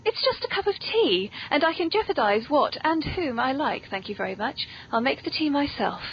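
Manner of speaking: ordinary delivery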